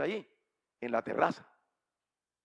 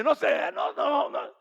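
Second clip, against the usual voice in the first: high, loud string of refusals; flustered refusal; 12 semitones higher, 9 dB louder, slower than usual. Retold or imitated. Wife refusing the evangelical, high flustered refusal. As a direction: shut him down in a raised voice.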